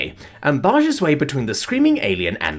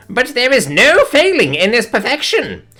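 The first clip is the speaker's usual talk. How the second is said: much higher, louder, grand proclaiming; dramatic alien rapture; 6 dB louder, slower than usual. A narrator's reading. Alien dancing with boba tea, proclaiming in ecstatic high rapture. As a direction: sing out praise mid-dance like a diva. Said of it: "starts doing a dramatic dance".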